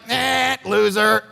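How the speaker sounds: slow, scornful "eh, loser"; mock dismissal. Crowd sneering at a praying Braveheart, slow mock scorn. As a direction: drag it out with lazy contempt. Audience mocking a praying hero.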